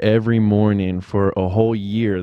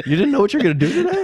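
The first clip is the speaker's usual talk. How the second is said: incredulous teasing; faster than usual. Retold or imitated. Teasing friend about winging it.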